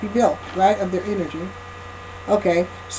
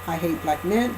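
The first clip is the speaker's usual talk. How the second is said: softer, calmer take on the same words; corrected quieter voice; 3 semitones lower, 7 dB quieter than usual. Shown as a demonstration. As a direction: say it quietly, more like a real woman would.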